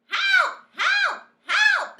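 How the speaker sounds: shrill high screams for help; terrified cook screaming. A narrator's reading. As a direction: scream in sheer panic. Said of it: "the man was screaming".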